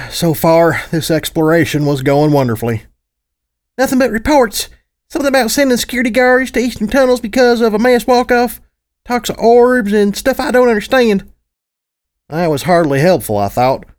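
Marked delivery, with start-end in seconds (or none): 3.8-11.3 put-on high small girl's voice; chirpy young filly reading off the screen; little filly voice.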